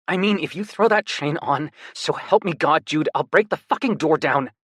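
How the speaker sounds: a half-joking threat to his brother; snarled. A narrator's reading. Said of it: "he snarled".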